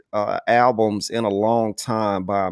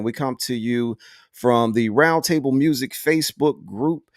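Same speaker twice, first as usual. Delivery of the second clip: ordinary delivery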